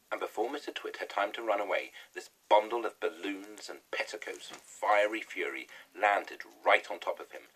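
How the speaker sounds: usual speaking voice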